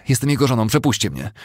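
high, loud, desperate demand; growing despair. A narrator's reading. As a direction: insist they let you through. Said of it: "Z rosnącą rozpaczą".

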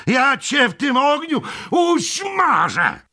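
high-pitched threatening cry; defiant shout. A narrator's reading. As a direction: hurl a threat back at the attacker. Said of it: "woła sędzia".

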